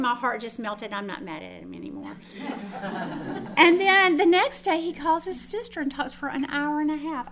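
ordinary talk, nothing marked

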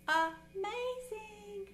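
high and drawn out in wonder; amazing said high and slow in relief; awed. Retold or imitated. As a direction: stretch it high and slow, marvelling. Retold relief after prayer.

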